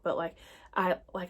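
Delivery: ordinary talk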